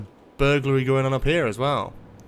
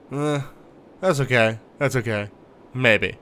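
normal talking voice

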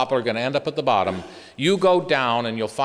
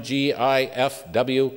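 ordinary talk

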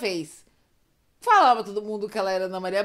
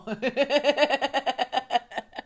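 long real laughing fit. Real, not acted. Storyteller about to imitate a shout, long helpless laugh. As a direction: break up laughing mid-story.

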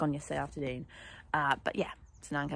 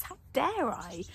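mock outrage; 7 semitones higher, slower than usual. Mock indignation at horse.

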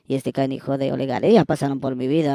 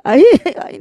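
laughing; 13 semitones higher, 9 dB louder than usual. Real, not acted. Laughs at own slip.

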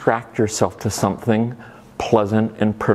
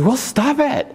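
mock scolding. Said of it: "we can't just tell our minds".

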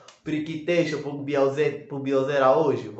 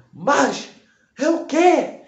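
much higher, loud freaked-out yelling; retold outburst, much higher and louder; shouting; 10 semitones higher, 4 dB louder than usual. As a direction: yell it high in a panic. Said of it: "Começou a gritar".

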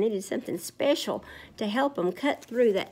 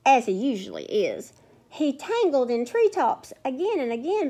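lilting read-aloud rhyme; sing-song story time voice; storybook reading; 4 semitones higher, 4 dB louder than usual. Acted. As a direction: read it like a picture book to kids. Reads rhyming story to children.

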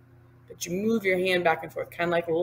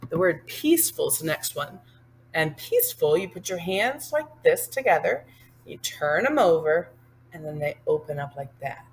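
ordinary talk

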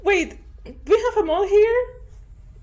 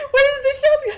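frantic cry; 8 semitones higher, 4 dB louder, faster than usual. Real, not acted. Frantic search mid-attack.

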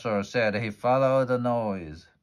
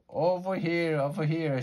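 high, calling shout; excited shout, much higher; 9 semitones higher than usual. Acted. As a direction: call out to be found in the fog. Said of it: "shouted Detective Dinosaur".

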